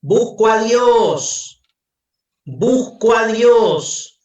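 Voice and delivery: a madman crying out in the market, drawn-out and loud; madman shouting. Acted. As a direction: bellow it slowly like a desperate madman.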